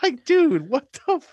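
high, loud "what the fuck"; a loud, high-pitched yell of disbelief; mock outraged yell. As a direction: blurt it out in disbelief. Outraged at the diarrhea.

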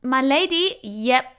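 high, loud put-on posh greeting; mock posh doorman. Imitating the doorman greeting her grandly. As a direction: put on a fancy voice for her ladyship.

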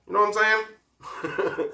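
high, slow You know what I'm saying; drawn-out knowing tease. Real, not acted. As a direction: stretch it out with a wink. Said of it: "repeated, drawn out higher".

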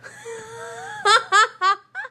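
high-pitched helpless laugh; burst of laughter. Real, not acted. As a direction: crack up at the punchline, squealing high. Laughing hard at own punchline.

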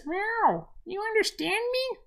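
very high, squeaky kitty voice; tiny high cat voice with meowed words; talking cat. Acted. Cat chatting to a strange human, high kitty voice. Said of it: "And the cat says".

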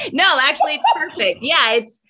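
giggling through the words; laughing. Real, not acted. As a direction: laugh it off, bright and high. Laughing at own wording.

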